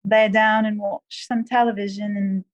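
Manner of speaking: British accent demo for the interviewer. Shown as a demonstration. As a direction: play the proper English host in a British accent. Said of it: "do a British accent?".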